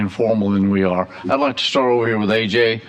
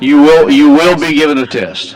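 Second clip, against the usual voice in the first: loud, raised-pitch joking threat; mock-stern warning; 4 semitones higher, 9 dB louder than usual. Real, not acted. Chair teases a senator who can't remember names, mock-stern. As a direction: playfully lay down the law, loudly.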